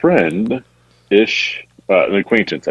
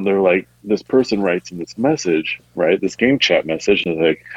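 ordinary talk

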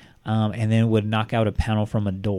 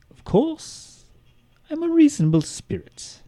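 much higher, sing-song fake politeness; mock polite spirit. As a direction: play the killer as sweetly reasonable. Mocking voice within the letter.

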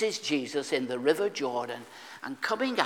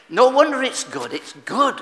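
higher and much louder, booming from the pulpit; loud preaching exclamation; 9 semitones higher, 10 dB louder than usual. Acted. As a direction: proclaim it out to the whole church. Preacher's exclaimed high point.

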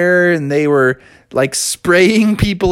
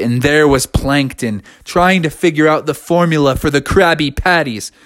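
usual speaking voice

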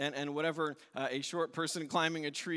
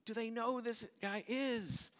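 grumbling; 7 semitones higher than usual. Acted. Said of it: "They all grumbled".

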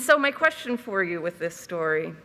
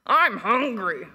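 cold voice. Acted. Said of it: "he said in a cold voice".

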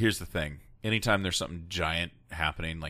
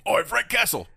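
loud, high-pitched Cockney holler; hammed-up Cockney shout; mock Cockney; 7 dB louder than usual. Co-host hailing Frank Castle as the hero, mock Cockney. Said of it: "if he was all Cockney".

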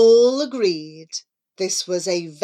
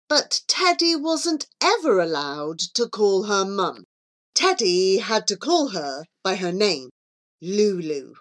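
ordinary delivery, nothing marked

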